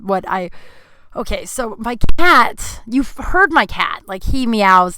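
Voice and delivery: ordinary delivery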